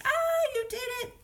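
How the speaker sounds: put-on high, excited cheering for a child; acted celebration. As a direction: cheer a new reader in a high voice. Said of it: "throw a little party".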